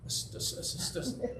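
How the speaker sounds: hushed, sputtering repeated consonants; mock stutter. Preacher shows how Moses couldn't speak well, mock stutter. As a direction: pretend to be unable to get past the first sound. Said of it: "That he had a stutter".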